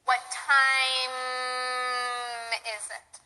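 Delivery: "time" ends with lips closed, humming M; closed M ending. Shown as a demonstration. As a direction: close your mouth on "time". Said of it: "Your mouth should be closed".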